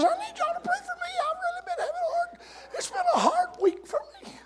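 high, fast, teary falsetto wail; desperate mock moan. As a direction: squeal the complaint in a hammed-up sob. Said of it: "for that desperate moan".

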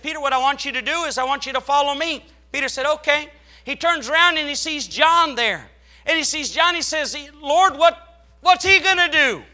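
loud, high, fast preaching retelling; preacher at full pitch acting out Peter; fiery preaching. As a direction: shout the story to the congregation.

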